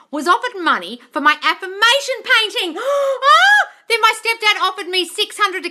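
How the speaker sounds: loud, high, thrilled; excited gasp. Real, not acted. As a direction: gasp with delight. Reading exciting comment aloud.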